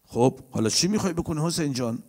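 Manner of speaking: ordinary delivery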